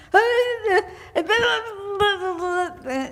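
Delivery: long high garbled wail; imitated excited babble. Eddie pointing at the church, imitated wordless babble. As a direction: holler wordless syllables, high and drawn out. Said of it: "and then he started saying".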